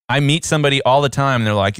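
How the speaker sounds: ordinary talk, nothing marked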